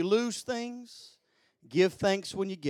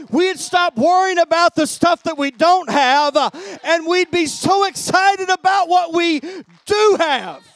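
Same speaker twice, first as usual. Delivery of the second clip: high, loud shouted sermon; impassioned pulpit shout; full-force preaching; 9 semitones higher, 15 dB louder than usual. Real, not acted. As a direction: preach it out at a shout, building higher and louder.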